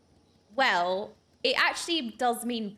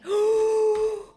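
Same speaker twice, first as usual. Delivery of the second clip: mock shocked gasp; 11 semitones higher, 7 dB louder than usual.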